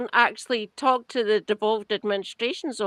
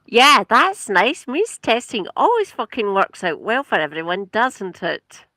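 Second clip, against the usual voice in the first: sarcastic; 6 dB louder than usual. Acted. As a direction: heap on mock praise with exaggerated emphasis. Sarcastic verdict on means testing.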